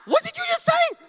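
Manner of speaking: very high, loud squawk of disbelief; shocked bride. Acted. Bride reacts to the pastor's slip, shrill shocked outburst. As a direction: shriek it as a stunned bride. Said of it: "she looks at him like".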